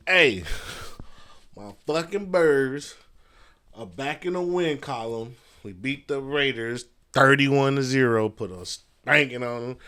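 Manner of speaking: loud, high, drawn-out cheer for his team; excited about the win. Real, not acted. Podcaster cheering his Eagles' big win to listeners, loud and excited. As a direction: hype it up like your team just won big.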